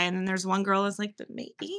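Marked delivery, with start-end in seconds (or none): 1.2-1.7 hushed, barely voiced hesitant quote; imitating a tiny, tentative voice; timid aside. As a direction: murmur it like a shy objection. Lone girl's timid dissent, retold.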